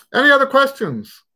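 bright high call for questions; high sing-song call. Real, not acted. Teacher calling for more questions.

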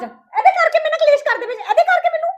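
very high-pitched whine; aggrieved outburst. Acted. Mother blaming the baby for the quarrel, aggrieved. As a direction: protest in a high, wounded voice. Mother complains of being blamed.

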